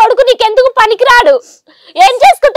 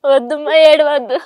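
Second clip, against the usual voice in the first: softer, real tears while soothing; tearful comforting; 7 semitones lower, 3 dB quieter than usual. Real, not acted. Daughter comforting her crying mother, tearful soothing.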